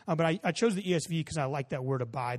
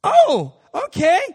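much higher, loud imitation of a child instantly won over; sudden happy realization; 12 semitones higher, 13 dB louder, much slower than usual. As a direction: flip to a delighted kid's voice. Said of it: "everything about his countenance changed in that moment".

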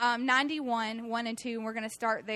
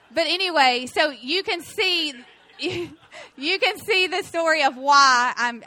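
talking through laughter, higher and louder; slow, cracked-up words breaking into laughs; real laughing; 5 semitones higher, 8 dB louder, slower than usual. Real, not acted. Speaker explaining her wasp terror to congregation, laughing.

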